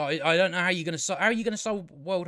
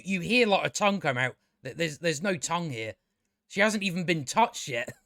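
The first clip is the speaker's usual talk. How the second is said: ordinary talk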